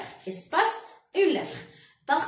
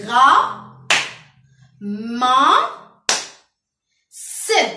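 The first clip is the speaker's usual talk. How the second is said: "Ra, ma, se" as three loud, separate beats; each of "Ra, ma, se" punched out alone; split into syllables; 9 dB louder, much slower than usual. Shown as a demonstration. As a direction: clap out "Ra... ma... se" with long gaps. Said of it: "Combien de fois je frappe".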